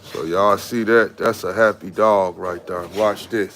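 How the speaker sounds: ordinary talk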